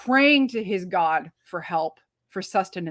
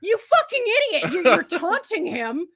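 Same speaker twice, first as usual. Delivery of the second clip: high-pitched comic outrage; exasperated scolding; 8 semitones higher than usual. Acted. Reviewer telling off the taunting god, exasperated. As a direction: berate a fool in a raised, incredulous voice.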